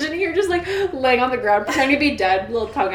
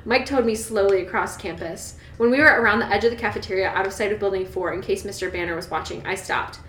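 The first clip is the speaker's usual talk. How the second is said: even, lower-pitched audiobook read; story narration; 3 semitones lower, 4 dB quieter than usual. A narrator's reading. Reader telling Bella's walk across campus, measured narration. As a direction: read the novel aloud, settled and steady. Reads the novel aloud.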